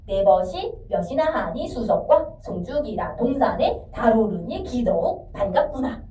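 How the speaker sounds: slow reading in four feet, pausing after "네 벗이" and "몇이나"; measured beats with small gaps, "수석과 / 송축이라"; four-beat phrasing. Shown as a demonstration. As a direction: break it evenly, "동산에 / 달 오르니 / 기더욱 / 반갑구나". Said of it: "이렇게 끊어 읽을 거래".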